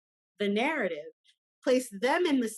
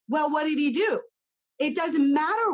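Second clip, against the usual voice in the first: indignant challenge; 5 semitones higher, 6 dB louder than usual. Retold or imitated. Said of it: "mock questioner voice".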